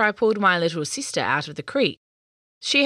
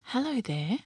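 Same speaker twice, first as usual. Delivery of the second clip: soft, gentle greeting, a touch higher; gently; 5 semitones higher, 4 dB quieter than usual. A narrator's reading. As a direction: greet a shy little creature softly. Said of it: "Violet said gently".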